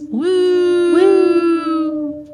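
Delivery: high howling woo like a wolf. Shown as a demonstration. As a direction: howl it out like a wolf. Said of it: "It sounds just like this".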